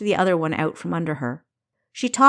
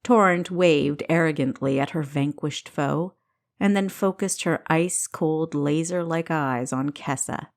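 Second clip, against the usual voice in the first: ordinary delivery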